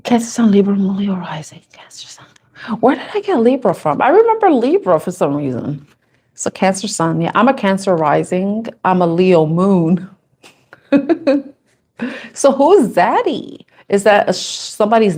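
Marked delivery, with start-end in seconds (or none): 0.8-1.6 breathy whisper, reading off a chart; whispered to herself. Real, not acted. Checking her own chart, whispers under her breath. 1.7-2.2 barely audible unvoiced whisper; faint whisper. Real, not acted. Rechecking her own sign placements, a faint whisper. 12.3-13.7 teasing. Real, not acted. Teasing a viewer's comment.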